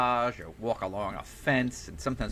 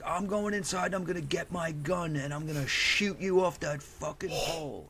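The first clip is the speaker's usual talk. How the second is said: retold rant in a raised, strained pitch; mock screamed threat; 7 semitones higher than usual. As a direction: imitate an enraged man threatening from below. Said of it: "he starts screaming at me".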